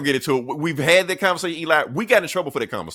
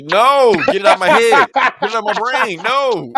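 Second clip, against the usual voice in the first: much higher, louder laughing groan of disgust; disgusted protest; 9 semitones higher, 10 dB louder than usual. Real, not acted. Reacting to the co-host's gross guess, real loud disgusted outcry. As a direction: yelp it like the thought itself is gross.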